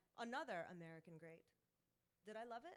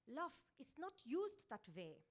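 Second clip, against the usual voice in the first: put-on slow, louder Austrian accent like Schwarzenegger; 4 semitones higher, 5 dB louder, slower than usual. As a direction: give it an Austrian action-hero accent, slow and heavy. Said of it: "in a Schwarzeneggerian way".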